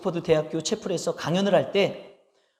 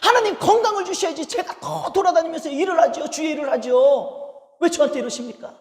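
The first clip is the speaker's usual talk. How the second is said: higher, louder indignant complaint; grumbling to God; 7 semitones higher, 4 dB louder than usual. Acted. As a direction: demand an answer from God. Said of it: "불평할 수 있어요".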